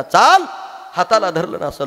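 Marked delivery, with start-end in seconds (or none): 0.0-0.4 harsh dismissal. Acted. Uncle drives her away.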